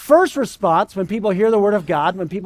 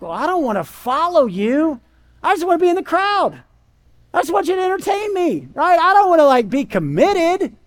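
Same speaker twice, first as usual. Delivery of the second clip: much higher whiny mock crowd; 8 semitones higher than usual. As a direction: whine like a fan who won't commit. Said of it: "they were like".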